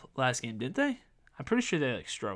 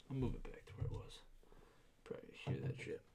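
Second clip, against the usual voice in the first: breathy whispered aside away from the mic; whispering to himself while fixing the mic; muttered aside; 17 dB quieter, faster than usual. Real, not acted. Host fixing his mic mid-episode, whispering.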